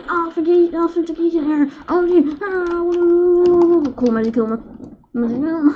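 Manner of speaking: high, loud mock game voice. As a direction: squeal it in a silly put-on voice. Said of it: "And it's like".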